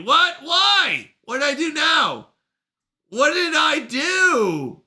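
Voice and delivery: high, wailing mock protest; mock dismay. Streamer reacting to being told they'll be left, mock wail. Acting out dismay at chat message.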